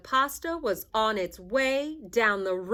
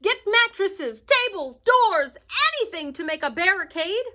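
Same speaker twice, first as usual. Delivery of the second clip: high, loud commands, fast at the end; rally the town quickly; mayor shouting orders to the townspeople; urgent orders; 7 semitones higher, 3 dB louder than usual. A narrator's reading. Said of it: "shouted the mayor".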